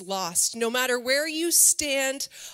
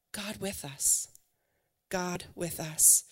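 hushed answer; 5 semitones lower, 8 dB quieter, slower than usual. Acted. Quiet answer to own question.

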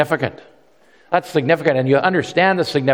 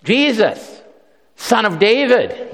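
raised, pleading call; crying out; 8 semitones higher, slower than usual. Retold or imitated. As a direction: call out high to Jesus for mercy. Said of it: "he begins to cry out".

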